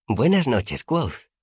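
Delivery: put-on high, light childlike voice; Auri's girlish greeting. A narrator's reading. Auri greeting her friend on the rooftop, high girlish voice. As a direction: greet like a shy sprite.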